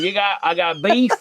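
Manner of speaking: put-on Southern US accent with a B on lamb. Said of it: "Southern accent ever".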